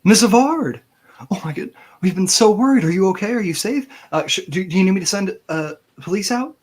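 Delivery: high, loud, rushed worry; a panicked secretary who can't keep calm; flustered secretary. Acted. Secretary panicking at the missing boss's daughter on the phone.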